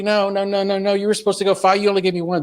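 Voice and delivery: high scolding protest; frustrated parent. Retold or imitated. Tempted parent correcting Adam, high and exasperated. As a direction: nag him for breaking the deal. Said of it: "the temptation is to say".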